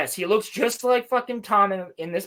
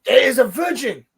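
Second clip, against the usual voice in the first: higher, louder mock foreign accent; Sol impression; 5 semitones higher, 6 dB louder than usual. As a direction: mimic a new English speaker's heavy accent. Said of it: "it sounds like he's saying virgins".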